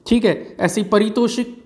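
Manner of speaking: ordinary delivery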